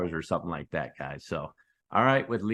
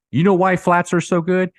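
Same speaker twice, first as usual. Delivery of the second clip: louder and higher, pressing the question; excited emphasis; 9 semitones higher, 8 dB louder than usual. Real, not acted. YouTuber presses viewers on flats, louder and excited. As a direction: hit it again with real excitement.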